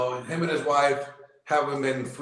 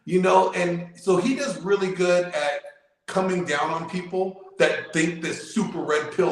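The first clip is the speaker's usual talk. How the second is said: usual speaking voice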